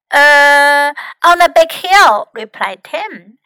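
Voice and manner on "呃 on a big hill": loud, drawn-out hesitation, then the answer; thinking on the spot. A narrator's reading. Tim invents a picnic spot for the rat, hesitating loudly. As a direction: stall with a long filler, then answer loudly. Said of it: "had to think very quickly".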